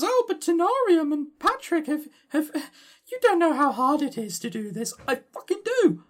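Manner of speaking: high-pitched exasperated outburst; indignant high rant at the doubters. Real, not acted. Ranting against imagined critics.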